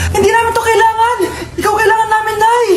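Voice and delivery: high, fast hammed-up crying; mock tearful plea. Children to dying mother, hammed-up tearful plea. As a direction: cry out that only mom matters.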